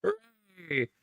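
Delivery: high, flat mock cheer; sarcastic hooray. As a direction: fake cheer, laughing. Sarcastic cheer about pee.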